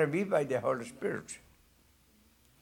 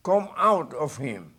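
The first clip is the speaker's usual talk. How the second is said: stern; 4 dB louder than usual. A narrator's reading.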